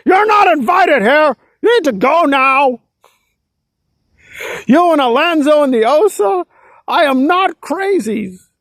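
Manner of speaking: high, forceful mock shouting; mock angry tirade. Nora ordering someone out, high-pitched mock tirade. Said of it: "She was just angry".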